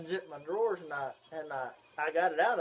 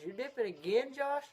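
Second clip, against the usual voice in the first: high, rising accusing question; lightly voiced grandma catching him out; imitating grandma; 9 semitones higher than usual. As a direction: climb in pitch like a disapproving grandma. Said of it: "and she says".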